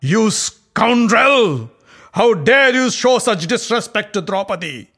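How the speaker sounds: enraged Bhima threatening Duryodhana; bursting with rage. A narrator's reading. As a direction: hurl a vow of vengeance. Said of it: "Bursting with rage".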